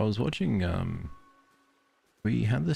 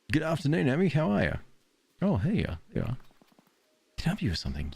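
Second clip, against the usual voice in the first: higher, friendly shopkeeper voice; cheery villager greeting; 10 semitones higher, faster than usual. Acted. Streamer voicing a game villager greeting her, chirpy and higher. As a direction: greet the player like a chirpy neighbour.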